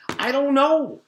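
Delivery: high, loud, throwing-up-hands wail; exasperated cry. A narrator's reading. Calvin overwhelmed by tattoo placement questions, shouting in exasperation. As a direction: give up loudly, at the end of your rope. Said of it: "Calvin threw up his hands".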